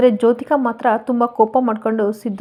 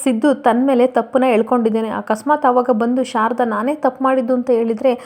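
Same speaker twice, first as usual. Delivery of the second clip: ordinary delivery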